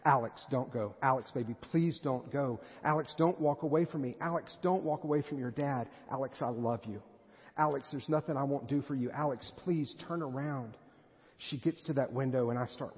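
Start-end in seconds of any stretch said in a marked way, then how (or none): 0.0-10.8 hammed-up begging, stretching out the pleas; re-enacted insistent, emotional plea; laid-on-thick pleading. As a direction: play a dad pleading with his little girl. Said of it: "I lay it on thick".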